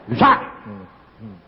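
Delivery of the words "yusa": curt, stern call of the name. Retold or imitated. God calling the stubborn husband by name, stern. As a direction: call him sharply like a strict father.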